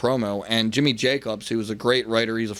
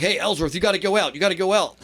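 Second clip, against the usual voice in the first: higher-pitched hurry-up call; urgent call-out; 7 semitones higher than usual. Retold or imitated. Backstage staff sending wrestler to the ring, urgent call. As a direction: imitate a crew member hustling someone out.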